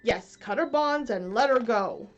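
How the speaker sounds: loud captain's order; Hook's voice. Acted. Peter as Hook repeating the order, loud. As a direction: command it like Hook. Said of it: "imitated the voice of Hook".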